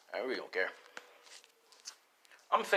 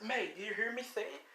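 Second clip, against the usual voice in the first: excited; 10 semitones higher, 10 dB quieter than usual. Acted. Dick asking May eagerly.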